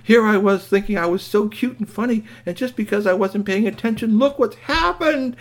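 high, loud self-reproach, slowing at the end; distraught robot. Acted. Robot blaming himself for the crash, distraught. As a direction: lament it up high, building to a wail.

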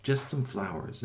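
usual speaking voice

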